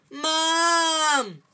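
high, long panicked howl; terrified wailing shout. A narrator's reading. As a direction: yell for mum at full volume. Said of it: "howled Dudley".

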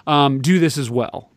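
usual speaking voice

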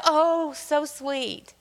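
very slow, drawn-out swoon; mock gushing. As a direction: coo it like the crowd melting. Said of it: "everybody's like".

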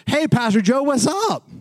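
high, imitated call-out; insistent street greeting. Street guy insistently greets him again.

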